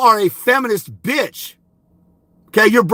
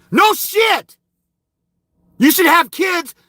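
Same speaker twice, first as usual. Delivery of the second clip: angry shout; 7 semitones higher, slower than usual. Real, not acted. Yelling at the commenter who stated the obvious, high furious shout. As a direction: bark it at him like he's an idiot.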